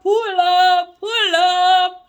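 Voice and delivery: high, loud drawn-out holler; hype call. Real, not acted. Reader reacting to a powerful quote, hype call.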